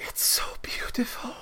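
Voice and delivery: breathless high whisper of wonder; awed whisper. Acted. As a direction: hush in awe at the bloom. NPC awed by the plant.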